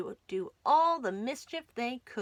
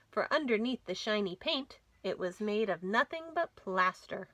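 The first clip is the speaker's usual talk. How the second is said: ordinary delivery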